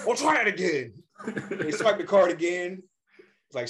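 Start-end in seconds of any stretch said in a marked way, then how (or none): 0.1-0.9 high-pitched, irate insistence; hammed-up anger, pitch pushed up; mad customer. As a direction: imitate a fuming customer repeating his demand. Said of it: "Like, he's mad".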